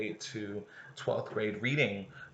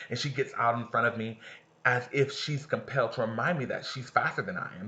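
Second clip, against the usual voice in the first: ordinary talk, nothing marked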